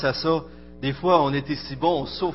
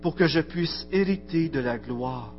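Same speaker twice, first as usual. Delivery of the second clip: lecture recueillie; 3 semitones higher than usual. Acted. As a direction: read it like a litany over music, rising then fading. Recites a devotional text over music. (lecture solennelle et recueillie sur fond de musique)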